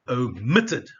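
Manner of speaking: OMITTED with loud MIT; second-syllable stress. Shown as a demonstration. Teacher shows the stress, OMITTED punched on MIT. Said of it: "on the second syllable".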